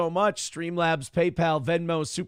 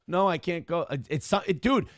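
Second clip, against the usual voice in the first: normal talking voice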